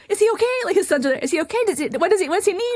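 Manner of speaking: high, urgent, worried questions; alarmed. Retold or imitated. Nurse asking sons what the yelling patient needs, alarmed and high. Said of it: "And I'm like".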